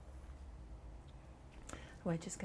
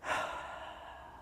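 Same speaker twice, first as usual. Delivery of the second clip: soft, breathy demonstrated sigh; long sigh out; 5 dB quieter than usual. Shown as a demonstration. Instructor shows the class a sigh out, slow release. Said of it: "a sigh out all the way".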